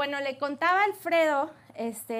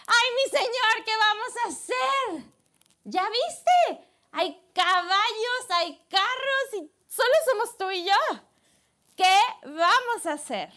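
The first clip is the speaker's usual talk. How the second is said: panicked servant; 7 semitones higher, 5 dB louder than usual. Acted. Servant running to Elisha panicked.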